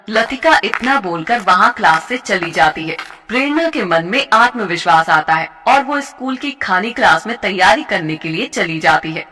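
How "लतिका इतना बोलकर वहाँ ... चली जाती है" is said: ordinary delivery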